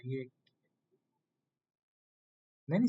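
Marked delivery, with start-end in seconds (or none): none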